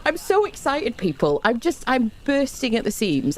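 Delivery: high burst of real excitement; excited. Real, not acted. Streamer bursting at the seams to chat, high excited burst. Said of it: "I'm that excited".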